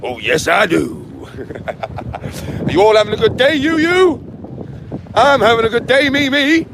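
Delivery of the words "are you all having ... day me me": sing-song greeting. Pretend.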